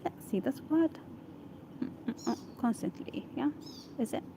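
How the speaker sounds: very soft, half-voiced trying out of a word; muttering to oneself. Real, not acted. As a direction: murmur it privately while working it out.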